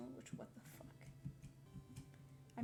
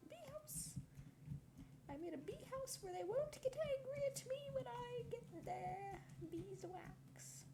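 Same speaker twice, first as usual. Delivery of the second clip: squeaky, higher cutesy voice for "bee house"; 9 semitones higher, slower than usual. Pretend. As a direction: coo it like a cartoon critter.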